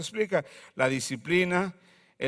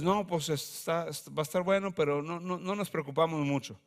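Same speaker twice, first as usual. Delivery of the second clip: unbothered shrug; 2 semitones lower, 7 dB quieter than usual. Pretend.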